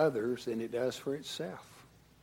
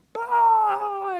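high, loud mock "Bah!" burst; kids' blurted noise; 12 dB louder than usual. Pastor imitating his kids shouting in the back. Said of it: "they burst out with some just".

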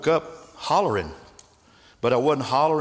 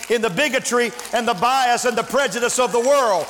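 higher, louder, building preacher's cry; preaching at full force; 10 semitones higher, 4 dB louder than usual. Acted. Preacher firing up his congregation in full force. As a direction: lift the church with rising fervor.

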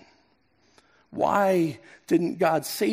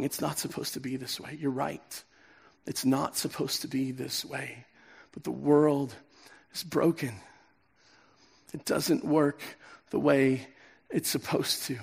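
much softer, low and heavy; heart sinking; 5 semitones lower, 7 dB quieter than usual. Pretend. Pastor voicing a grieving heart after a cancer diagnosis, hushed and sad. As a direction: let the words sink as if hearing bad news. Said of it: "something in your heart just sinks".

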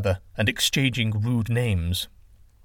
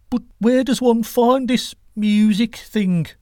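high, questioning character voice; puzzled god in a high voice; curious wind god; 10 semitones higher, 4 dB louder than usual. Acted. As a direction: ask innocently, pitched well up.

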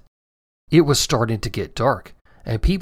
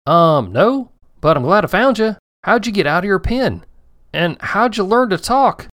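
higher, louder astonished farmer; amazed farmer; 7 semitones higher, 4 dB louder than usual. Acted. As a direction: marvel at a talking dinosaur. Farmer talking to dinosaur.

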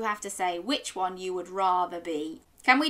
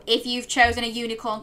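ordinary delivery

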